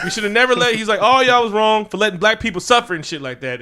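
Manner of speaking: loud, high mock outrage; hammed-up indignant rebuke; acted accusation.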